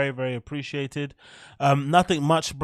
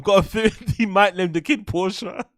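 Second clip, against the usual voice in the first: laughing; 6 semitones higher, 3 dB louder than usual. Real, not acted. Laughing through the line.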